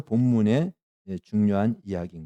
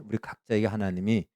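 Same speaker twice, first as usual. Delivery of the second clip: ordinary talk, nothing marked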